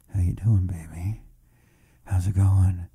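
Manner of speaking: mock seducer. Accuser imitates the host's flirting, mock seductive. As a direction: drop deep into a sleazy mock-sexy murmur. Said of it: "and he went".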